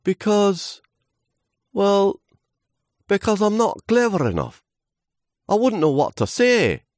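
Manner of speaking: very high, halting voice; timid little leaf. Acted. Leaf not clever enough for wind, high timid voice. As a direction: hesitate between phrases, high and nervous.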